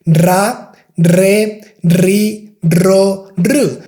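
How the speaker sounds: "ra, re, ri, ro, ru" each with a rolled R; trilled R with vowels. Shown as a demonstration. Teacher running the R drill. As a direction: roll the R through every vowel. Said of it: "practice this R sound with all the vowels".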